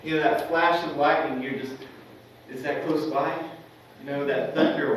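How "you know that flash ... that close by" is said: ordinary delivery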